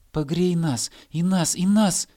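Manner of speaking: little voices clamouring, "и нас!"; eager begging. Acted. Grass blades begging the hare for warmth, clamouring.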